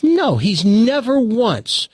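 high, drawn-out daughter voice; protesting daughter. Acted. Daughter defends husband in joke.

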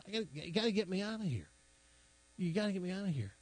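a hint of a desperate horse begging; panicked horse. Horse trapped in well pleading.

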